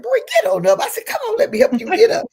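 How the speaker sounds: high, loud retold urging; playful scolding. Woman retelling how she urged him up, playful scolding. Shooing him up from his knee.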